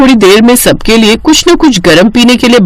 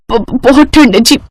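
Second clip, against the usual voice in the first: shivering with cold; 2 semitones higher than usual. Acted. Wife tells husband she is too cold, shivering. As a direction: stammer from the chill. Freezing wife during snowfall.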